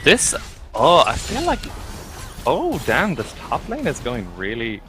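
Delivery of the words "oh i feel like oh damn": usual speaking voice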